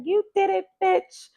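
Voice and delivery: imitating a woman pinning the blame; mock accusing. Acting out women blaming men.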